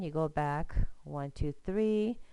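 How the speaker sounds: normal talking voice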